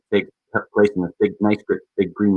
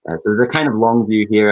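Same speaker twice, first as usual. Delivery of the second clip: ordinary talk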